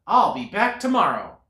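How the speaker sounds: a cheery squirrel promise. A narrator's reading. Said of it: "said the squirrel".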